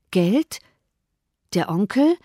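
mildly astonished, halting questions; understated amazement. A narrator's reading. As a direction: let each short question hang in puzzlement. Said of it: "rief Jane erstaunt".

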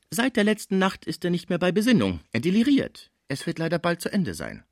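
high, plaintive report; doorkeeper woman, lamenting. Acted. (klagende Pförtnerin, hohe Stimme)

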